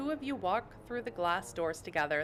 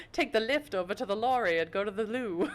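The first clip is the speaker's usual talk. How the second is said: hammed-up British, breaking into laughter; silly put-on British; mock British accent; 4 semitones higher, 4 dB louder than usual. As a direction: exaggerate the British words for laughs. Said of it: "really weird British stuff".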